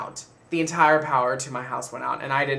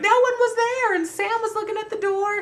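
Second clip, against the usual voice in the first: much higher, hushed-eerie cousin voice; spooked cousin. Retold or imitated. Cousin telling about the empty doorstep, high voice. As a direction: pitch it way up as the cousin. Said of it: "she was like".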